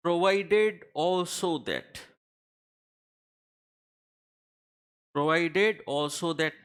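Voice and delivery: each word drawn out very slowly; slow dictation. Shown as a demonstration. Teacher reads the proviso wording to students, very slow dictation. Teacher spells out drafting phrase.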